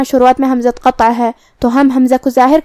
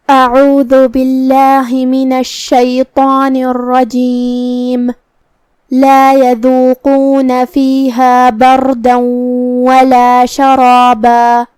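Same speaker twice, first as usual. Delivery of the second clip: slow, chanted tajweed recitation; Quran recitation; much slower than usual. Shown as a demonstration. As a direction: recite the verse slowly in melodic chant. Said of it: "تو شروع کرتے ہیں". (دھیمی ترتیل سے قرآن کی تلاوت)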